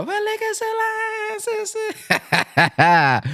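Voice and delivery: high mock greeting; imitating believers. Host teasing listeners by mimicking their greetings. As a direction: squeak the reply up high. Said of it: "Y'all be".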